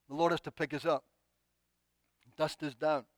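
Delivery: usual speaking voice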